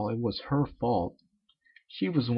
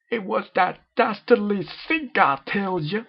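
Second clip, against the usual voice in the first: higher, louder, slow hammy accusation; hammed-up blame; 10 semitones higher, 7 dB louder, slower than usual. Rainbow blames the sink to Miss Cake, hammed-up. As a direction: blame the sink like a stage villain.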